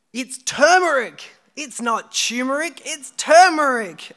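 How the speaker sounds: high, loud, drawn-out protest; mock exasperation. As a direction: insist on it in hammed-up, swooping outrage. Mock outburst insisting on pronunciation.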